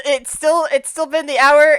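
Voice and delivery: usual speaking voice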